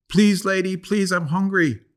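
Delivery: high, pleading little dog voice, slowing down; begging dog. Acted. Little dog begging the woman for scraps, high and pleading. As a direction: plead like a hungry puppy.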